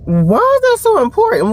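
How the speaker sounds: high indignant question; mock outrage at the nosy manager. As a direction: push back, offended and high.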